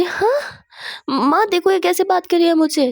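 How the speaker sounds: high, loud acted indignation; offended complaint. Daughter telling on her partner to mother, offended high protest.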